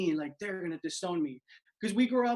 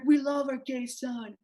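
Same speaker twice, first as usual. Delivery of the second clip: proud parents; 8 semitones higher, 5 dB louder than usual. Retold or imitated. Parents at Pride, mimicked.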